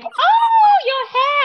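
mock-shocked office gossip; surprised coworkers. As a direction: exclaim over the new hair like a shocked colleague. Coworkers reacting to new hairstyle.

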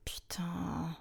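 deflated whispered swear; whispered curse. Pretend. Contestant after losing, whispered curse. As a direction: breathe the curse out, defeated.

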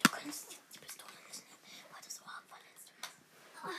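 fast breathy whisper. Real, not acted. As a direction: whisper the rules quietly. Whispered game instruction. (geflüstert)

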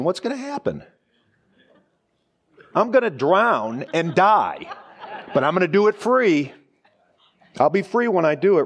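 pitched high, laughing through the bravado; mock triumph. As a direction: play up the foolish hero, half laughing. Mock daredevil swim across Atlantic.

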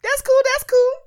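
very high, loud squeaky parroting; Dion impression. Joining in on what Dion keeps saying, high mock chirp. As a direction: chirp the catchphrase over and over. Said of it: "Dion keeps saying".

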